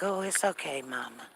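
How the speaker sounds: a hint of soft, low comforting; lightly voiced son calming his mother; soothing son. Son comforting his crying mama, mildly soft. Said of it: "And he'd be like".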